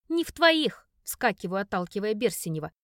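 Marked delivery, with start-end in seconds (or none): 0.1-0.9 loud, furious outburst; angry shout. Acted. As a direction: snap back at him, full force. Heroine jumps up shouting. (гневный крик)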